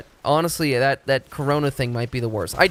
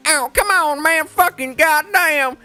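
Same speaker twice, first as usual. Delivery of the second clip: high, loud mock bro yelling "ow, come on, man"; mocking Texan bro; 9 semitones higher, 4 dB louder than usual. Mocking how people react to Texans with a loud rowdy bro voice. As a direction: ham up a rowdy Texas guy yelping. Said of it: "and they go".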